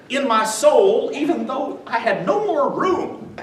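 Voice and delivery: usual speaking voice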